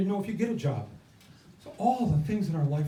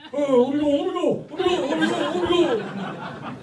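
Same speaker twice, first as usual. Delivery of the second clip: high, whiny mock protest; imitating Bernie; 9 semitones higher than usual. Protester being hauled off by police, mock whining to be let go. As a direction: play old Bernie squirming free of the police, laughing through it. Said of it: "and he's like".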